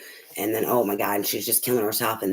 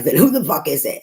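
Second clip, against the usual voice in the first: mock yell of an angry daughter, higher and louder; daughter's angry yell; 6 semitones higher, 6 dB louder than usual. Mother imitating her angry daughter's shouted question. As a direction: throw back her furious question. Daughter yelling in retold quarrel.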